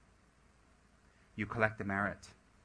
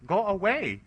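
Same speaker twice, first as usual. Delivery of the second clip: high, louder dismissal; mock brush-off; 8 semitones higher, 7 dB louder, slower than usual. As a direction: shoo off a pestering donor. Workers brush off eager donor.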